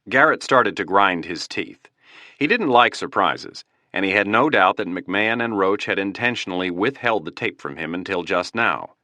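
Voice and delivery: plain reading voice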